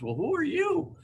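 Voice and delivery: put-on high, childlike voice asking who she is; little boy's question. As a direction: pitch up like a small curious boy. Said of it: "and he goes".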